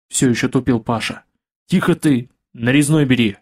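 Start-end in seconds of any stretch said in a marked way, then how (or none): none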